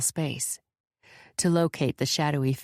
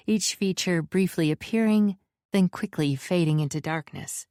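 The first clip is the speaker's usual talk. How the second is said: plain reading voice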